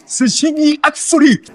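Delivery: high, slow, stretched-out exclamation; glory in every word; exultant boast. Acted. Revelling in being an absolute genius, exultant boast. S'exalte de son génie.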